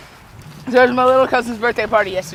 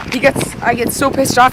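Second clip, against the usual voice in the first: normal talking voice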